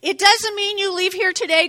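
usual speaking voice

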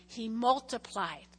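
normal talking voice

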